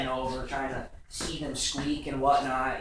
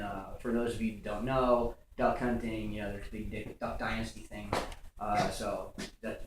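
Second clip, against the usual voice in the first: usual speaking voice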